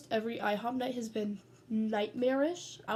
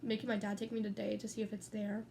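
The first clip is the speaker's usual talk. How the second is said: usual speaking voice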